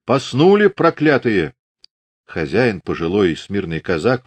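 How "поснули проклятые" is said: raised, higher-pitched demanding shout; booming shout. A narrator's reading. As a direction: call out loudly to people behind a door. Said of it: "зычно кричал".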